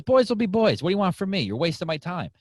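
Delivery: put-on high, lightly Italian-accented father. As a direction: retell dad's protest with a hint of Italian accent. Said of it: "kinda Italian accent".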